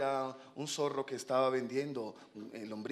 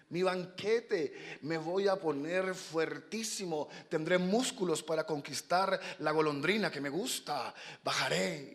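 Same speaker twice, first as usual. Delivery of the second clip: higher, slow, savoring delivery; dreamy boastful swallow; 3 semitones higher, slower than usual. Acted. Young swallow dreaming of muscles to win a sweetheart, boastful. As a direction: relish each phrase like a daydream.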